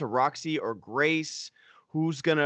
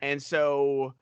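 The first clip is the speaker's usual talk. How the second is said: ordinary delivery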